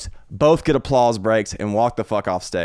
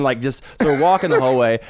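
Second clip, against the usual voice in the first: usual speaking voice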